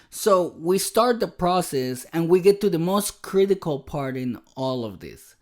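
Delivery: ordinary talk, nothing marked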